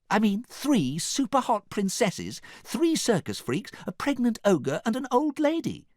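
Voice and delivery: high princess voice, itemizing. A narrator's reading. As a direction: list them off high and emphatic.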